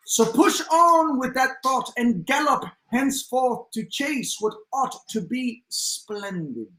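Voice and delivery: measured poem recital in hybrid British accent. Shown as a demonstration. Co-host reading the author's poem aloud, hybrid British accent. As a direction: declaim verse in a loosely British mixed accent. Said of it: "I did some kind of hybrid accent".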